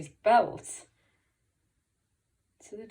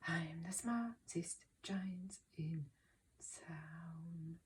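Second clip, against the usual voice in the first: breathy whispered closing line; 11 dB quieter, much slower than usual. Shown as a demonstration. Teacher finishing the song for children in a whisper. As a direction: drop to a secret whisper.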